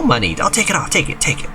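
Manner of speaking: a high little voice pleading; high pleading figure. Acted. Villager begging to take the bracelet, high and pleading.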